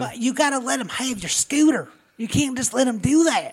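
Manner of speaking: put-on over-the-top country accent. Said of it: "everybody down there talks really like this".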